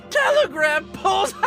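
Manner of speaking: high-pitched crazed disbelief; hysterical, mocking question; manic incredulity. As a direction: squeal it, on the edge of laughter. Madman incredulous at absurd war cause.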